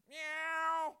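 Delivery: loud, high imitated cat meow. Preacher mimics the cat yowling at the back door, loud and high.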